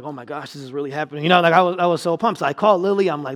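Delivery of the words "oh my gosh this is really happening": soft, breathless oh my gosh; hushed excitement. Retold or imitated. Preacher retells first sale, awed and quiet. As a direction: whisper it like you can't believe it. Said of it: "I was so excited".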